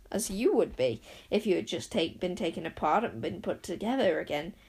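plain reading voice

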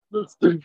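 bursting out laughing at the punchline; real laugh. Real, not acted. Laughs at his own punchline.

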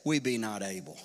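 cowering spies refusing, low and soft; fearful spies. Pretend. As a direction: shrink back in fear. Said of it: "the men ... said".

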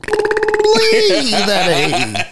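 squeaky, very high cartoon rabbit pleading; Roger's catchphrase. Retelling Roger's catchphrase to a friend, squeaky high cartoon plea. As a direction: whine the please way up like a frantic toon. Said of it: "Roger's kinda key catchphrase".

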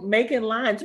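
ordinary talk

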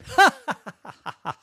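high mocking cackle; squid laughs. Squid laughing at the boy pirate's name, high cackle. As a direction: laugh at him, high and loud. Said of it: "said the squid".

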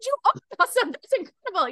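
high, loud burst of amazed praise; excited praise. Retold or imitated. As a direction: burst out in wonder. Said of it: "I start freaking out".

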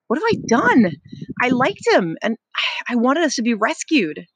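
anguished plea on one breath; low and breathless. A narrator's reading. Ralph to the twins, breathless and pained. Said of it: "his voice was low and seemed breathless".

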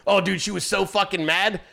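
usual speaking voice

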